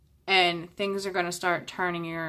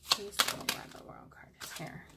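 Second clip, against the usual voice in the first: hushed, whispered plea; whispered request; 9 dB quieter than usual. Real, not acted. Reader asking the cards about the World card, whispering. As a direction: whisper it under your breath.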